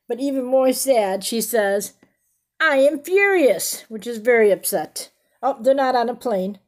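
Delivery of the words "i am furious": mock furious. Rereading the angry line.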